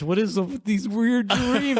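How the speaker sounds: high, panicked disbelief; rising alarm at the strange dreams; freaked out. Retold or imitated. Man waking from a vivid dream, panicked and high. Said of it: "I'm freaking out".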